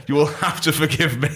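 normal talking voice

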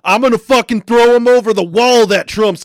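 hammed-up threatening yell; mock angry rant. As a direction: play the furious classmate, mockingly. Mocking angry classmates' threats.

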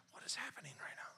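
hushed, quick, astonished whisper; stage-whispered bewilderment; whispered aside. Retold or imitated. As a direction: whisper it to your wife in disbelief. Stunned aside to his wife.

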